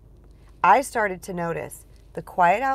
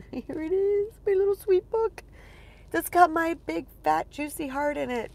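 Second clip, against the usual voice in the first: cooing; 10 semitones higher than usual. Real, not acted. Cooing over her new book, high and sweet. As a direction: coo at the book like a newborn.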